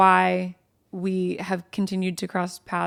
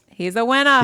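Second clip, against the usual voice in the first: put-on loud, higher Jersey-girl voice; Jersey accent imitation; 4 semitones higher, 6 dB louder than usual. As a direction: proclaim it with a mock Jersey accent. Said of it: "the Jersey girls".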